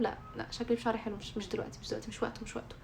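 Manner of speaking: a hushed inner argument that trails off; quiet inner voice. Retold or imitated. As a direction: murmur it to yourself, fading away. Arguing with herself about hijab.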